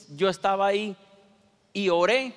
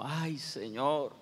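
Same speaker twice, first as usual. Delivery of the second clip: very slow, much softer sigh to the Lord; weary sigh; 4 semitones lower, 8 dB quieter, much slower than usual. Retold or imitated. Stuck behind a slow bus driver, a tired, soft sigh. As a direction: breathe it out, worn down.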